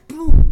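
a loud, high shouted boom; gunshot boom. Acted. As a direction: mimic a gunshot with your voice. Sound effect of gunshot.

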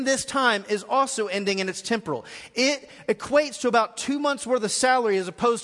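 preaching at full force. Acted. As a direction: drive the eternal debt home from the pulpit. Preaching Jesus' words with force.

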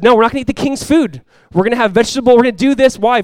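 high, loud, resolute refusal; Daniel refusing the king's food, loud and firm; hammed-up Daniel. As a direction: plant your feet like Daniel.

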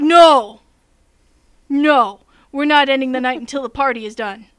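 loud, insistent shouting of no; retold protest shout. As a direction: players refusing to stop, yelling. Players refuse to end session.

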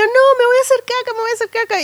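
high, frantic protest; mock panic. Mother in labour protesting to the midwife, high mock panic.

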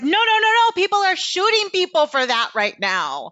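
high, loud panicked warning; alarmed shout. Retold or imitated. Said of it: "And I was like".

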